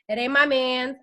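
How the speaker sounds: ordinary talk, nothing marked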